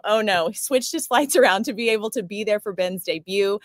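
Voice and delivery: ordinary talk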